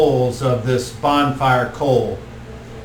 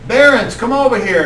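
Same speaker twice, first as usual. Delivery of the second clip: higher, louder calling out; stoner druid calling; 9 semitones higher, 5 dB louder than usual. Acted. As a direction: call the son over. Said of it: "He says".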